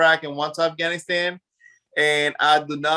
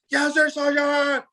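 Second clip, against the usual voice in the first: high, drawn-out mock military reply; recruits answering sergeant; 10 semitones higher, slower than usual. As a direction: imitate recruits sounding off to the sergeant. Mimics recruits answering drill sergeants.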